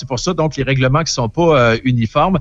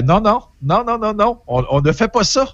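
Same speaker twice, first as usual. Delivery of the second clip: higher mock protest from an English speaker, repeated nons; anglophone friend refusing; 8 semitones higher than usual. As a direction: play the anglophone friend flatly refusing, pitched up. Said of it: "Il fait".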